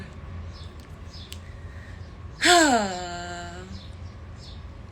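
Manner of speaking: long, breathy exhale; exasperated sigh. Real, not acted. Worn out from venting to viewers, sighing heavily. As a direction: blow out the frustration slowly. Exhausted after venting anger.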